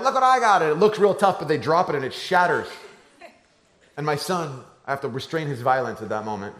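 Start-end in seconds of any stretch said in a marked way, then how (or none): 0.0-0.6 put-on higher, louder, a kid showing off a toy; proud little kid. Little sibling showing off the spaceship, eager kid voice. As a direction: pipe up like a thrilled little kid. Younger kids grab the Legos.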